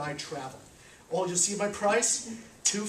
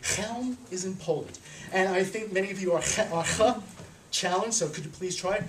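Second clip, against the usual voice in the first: normal talking voice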